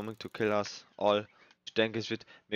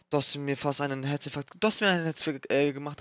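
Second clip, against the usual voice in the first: shaken after scare; 4 semitones higher, 4 dB louder than usual. Real, not acted.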